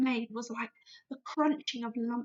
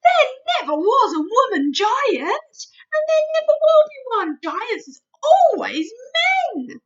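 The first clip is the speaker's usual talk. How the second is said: higher, louder giant declaring; BFG, insistent; 12 semitones higher, 12 dB louder than usual. Acted. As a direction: proclaim it as a giant. BFG voice in story.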